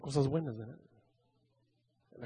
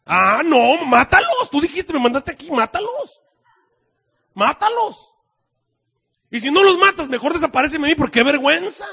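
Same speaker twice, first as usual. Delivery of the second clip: high, loud furious demand; angry Jonah; 8 semitones higher, 10 dB louder than usual. Acted. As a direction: shout it up high like a prophet in a tantrum. Jonah voiced angrily demanding destruction.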